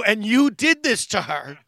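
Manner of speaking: loud, slow, emphatic blame; retold accusation. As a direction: pin it on the culprit at full volume. Blames bartender for crying wife.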